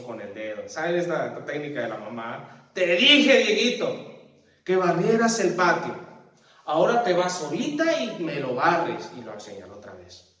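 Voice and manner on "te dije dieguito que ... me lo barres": sharp, much louder mom's rebuke; an angry mom who's had enough; scolding mother. Pretend. Mamá regañando a Dieguito, voz fuerte y enojada.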